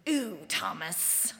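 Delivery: Schitt's Creek quote. Retold or imitated. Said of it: "borrowed a line from the T V show Schitt's Creek".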